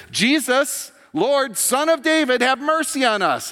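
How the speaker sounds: high, loud desperate call for mercy; blind men crying out. Retold or imitated. Blind men calling to Jesus over the crowd, loud desperate cry. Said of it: "crying out top of their lungs".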